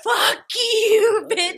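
high, loud yell at the thief; retold yell. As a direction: shout it after him.

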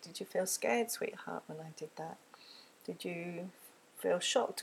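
put-on very soft, tender question to a child; sweetheart, barely whispered; gentle empathy guess. Shown as a demonstration. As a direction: ask softly, almost whispering. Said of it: "make some empathy guesses".

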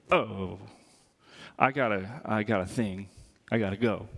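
low, deflated mock excuse; awkward excuse. New acquaintance edging away from a pastor, awkward. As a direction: act out backing out of a chat, voice dropped low.

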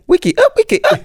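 high excited jungle MC shout; hyped MC call. Retold or imitated. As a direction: shout it like a rave MC. Mimics jungle MC hype.

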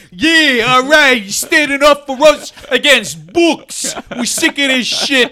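high, loud, slow cheering; mock bro cheer. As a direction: hype him up like a loud podcast bro. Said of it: "for Rewatchables like".

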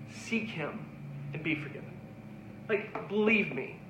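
earnest, softening appeal; pleading sermon close. Acted. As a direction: beg them gently to believe.

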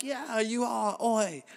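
high-pitched mock-British; bad British accent. Fans agreeing with the prince, hammed-up British accent. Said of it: "And that's my British".

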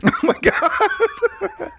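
high, drawn-out laughing exclamation; laughing disbelief. Real, not acted. Co-host reacting to the crying joke, laughing. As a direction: crack up on a long oh my God.

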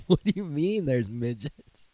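slow, laughing question; laughing disbelief. Retold or imitated. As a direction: ask it while barely holding in laughter. Workers laugh, quoted.

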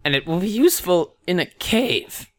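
high, slow fortune-teller voice. Acted. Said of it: "she's gonna say".

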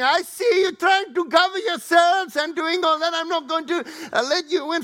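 high, loud mocking door lady; sharp high imitation of the woman at the door; stern door lady. Door lady blocking people.

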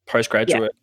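usual speaking voice

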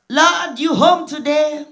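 hammed-up prayer, calling out to God; mock prayer. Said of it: "well, I'll pray".

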